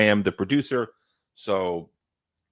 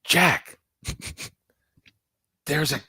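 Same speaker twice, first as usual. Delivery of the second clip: higher-pitched call to a friend, breaking into laughter; retold alarm; 6 semitones higher, slower than usual. Co-host retelling a sighting to Jack, mock alarm. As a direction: urgently get his attention, half laughing. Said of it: "And I said".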